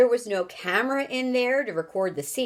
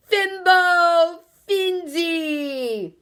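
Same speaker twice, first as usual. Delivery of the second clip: loud, much higher, slow unearthly cry; frenzied scream; 10 semitones higher, 6 dB louder, much slower than usual. Acted. Wonka answers Houston in gibberish, frenzied unearthly scream. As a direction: scream it wildly. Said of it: "in a frenzied, unearthly sort of scream".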